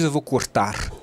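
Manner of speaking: "cortar" with the final R sounded out; R final pronunciado. Shown as a demonstration. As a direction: say the written R at the end of "cortar". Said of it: "Você não diz". ("cortar" com o R final bem pronunciado)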